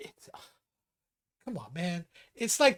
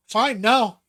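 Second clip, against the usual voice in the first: louder clipped mock mom finally refusing; mom gives in; 4 semitones higher, 8 dB louder than usual. A son imitates his mother finally saying no, loud and mock-annoyed. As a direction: give Mom's fed-up answer, louder. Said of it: "she'd just go".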